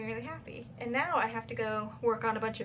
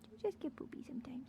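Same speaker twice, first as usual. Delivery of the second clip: whispered; 2 semitones higher, 11 dB quieter, faster than usual. Real, not acted. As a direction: whisper "You just get poopy sometimes." softly and quickly. Comforting dog, repeated.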